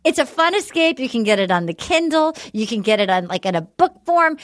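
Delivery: usual speaking voice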